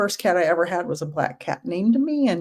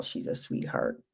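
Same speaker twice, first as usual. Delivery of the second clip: whisper; 11 dB quieter than usual. Real, not acted. Cooing over the cat.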